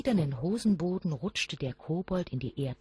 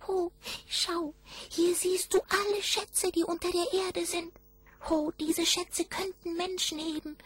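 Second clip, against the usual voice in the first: very high goblin voice, lingering on each oh; high kobold voice. A narrator's reading. Goblin presenting the hidden treasures, very high wondering voice. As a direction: marvel aloud like a tiny goblin guide. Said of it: "die Stimme des Kobolds".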